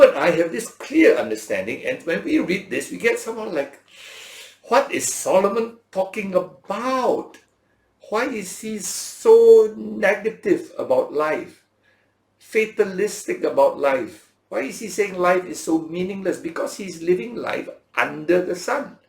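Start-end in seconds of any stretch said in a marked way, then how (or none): 4.6-16.4 mildly exasperated, higher and louder questioning; baffled questioning. Acted. Preacher voicing a baffled reader of Solomon, mildly exasperated questioning. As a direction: voice a reader puzzled by the text.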